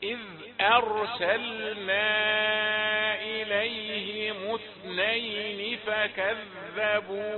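measured, very slow chant of the verse; slow Quran recitation. Shown as a demonstration. Teacher reciting the verse as it should sound. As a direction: chant it slowly with joined vowels. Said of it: "ونضم الميم للوصل".

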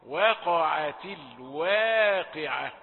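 slow, loud "وقعت الواقعة" with thin we; light waw. Shown as a demonstration. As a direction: draw out "الواقعة", keeping each w light. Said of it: "يعني رقيقة". (ترتيل "وقعت الواقعة" بواو رقيقة)

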